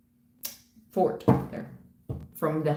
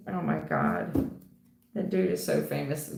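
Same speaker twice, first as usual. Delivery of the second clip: ordinary delivery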